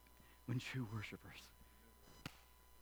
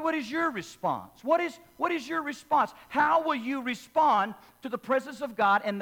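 louder, higher rising altar call; urgent pulpit appeal, louder and higher; preaching at full force; 5 semitones higher, 7 dB louder than usual. Acted. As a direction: press the crowd for an answer.

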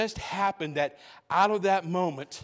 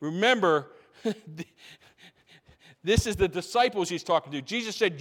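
ordinary talk, nothing marked

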